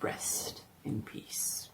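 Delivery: whispered farewell. A narrator's reading. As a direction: breathe it over the sealed wall. Final words over the tomb.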